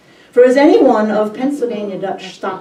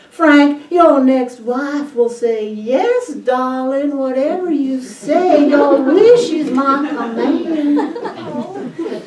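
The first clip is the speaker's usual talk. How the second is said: mock Southern belle drawl, higher, slow at the end; 6 semitones higher, 6 dB louder, slower than usual. Wife teasing husband about his next wife, in a hammed-up Southern drawl. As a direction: ham up a sugary Southern drawl, stretching the last line. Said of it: "in her version of Southern drawl".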